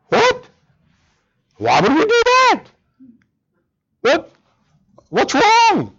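high, incredulous What? Why would we do that?; mock the congregation's alarmed disbelief; shocked protest. Preacher mimics church members balking at all-night prayer, high incredulous.

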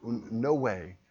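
slow, drawn-out refusal; slow God. Retold or imitated. God refusing Abraham, slow drawn-out refusal. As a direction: stretch out each word. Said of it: "And God said".

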